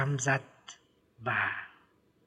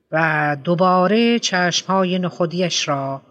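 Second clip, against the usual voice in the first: usual speaking voice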